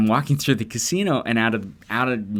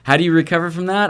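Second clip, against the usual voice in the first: mock exasperation; 8 semitones higher, 6 dB louder, faster than usual. Real, not acted. Echoing friend's question, louder.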